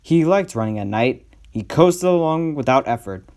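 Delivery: ordinary delivery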